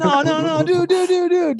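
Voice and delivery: high, rapid-fire protest of repeated words; mock frantic plea. Listener begging the boss to stop confessing, mock-panicked.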